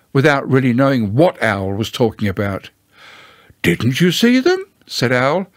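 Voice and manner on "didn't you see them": higher, rising mild surprise; a little surprised. Acted. Owl asks inattentive Pooh about the notices, mildly surprised. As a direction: question it with a light upward lift. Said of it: "a little surprised".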